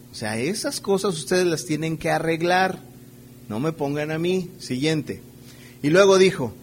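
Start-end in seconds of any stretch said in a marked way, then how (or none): none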